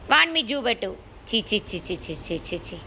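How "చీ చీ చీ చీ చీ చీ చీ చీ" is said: disgusted tsk. Real, not acted. Disgusted at the dirty pet.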